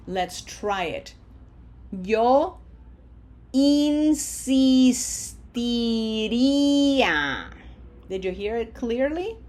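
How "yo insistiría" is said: "insistiría" very slow, all four i sounds held long; smiled long vowels. Shown as a demonstration. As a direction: smile through each "ee" of "insistiría", louder and stretched. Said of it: "We have to smile four times here".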